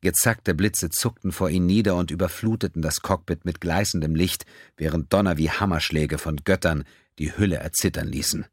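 ordinary delivery

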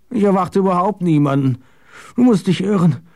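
high-pitched, bewildered protest; insistent denial. Acted. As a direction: deny it firmly in a high voice. Goddard denies expecting any visitor.

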